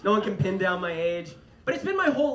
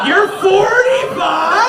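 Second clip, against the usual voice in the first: shocked shout; 11 semitones higher, 12 dB louder, much slower than usual. Retold or imitated. Bar girl shocked by his age.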